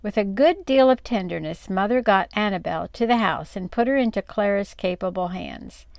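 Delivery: usual speaking voice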